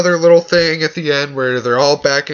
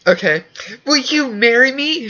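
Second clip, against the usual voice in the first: higher voice, lightly hammed-up; mock proposal; 11 semitones higher than usual. Mocking Ted proposing to Victoria, a higher giddy voice. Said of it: "Ted's like".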